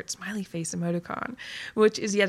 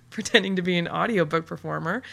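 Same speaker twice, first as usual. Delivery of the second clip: usual speaking voice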